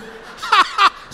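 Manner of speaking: a short airy laugh; high unvoiced chuckle; breathy laugh. Real, not acted. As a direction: laugh it off mid-sermon. Laugh mid-sermon.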